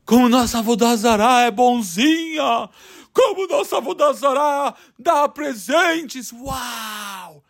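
slow, amazed cheering of the crowd; the crowd in the stands shouting in wonder; awed crowd. Acted. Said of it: "os goim ... falaram".

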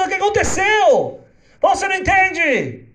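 put-on a girl telling everyone her news; excited little girl. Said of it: "menina responde aos outros". (menina gritando feliz, aguda e lenta)

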